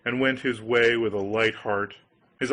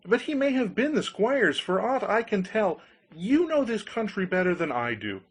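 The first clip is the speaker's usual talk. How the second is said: higher, eager voice for simple Hans; naive Hans pleading; 7 semitones higher than usual. Acted. Hans begs the countryman to swap the goose, lightly voiced higher and eager. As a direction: beg like a trusting young fool.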